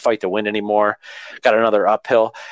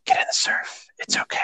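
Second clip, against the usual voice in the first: whispered gently, softer and unvoiced; calm soft whisper; 6 dB quieter than usual. Instructor waking a sleeping trainee, soft calm whisper. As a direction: coax softly in a hushed whisper. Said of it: "in a really calm, soft voice".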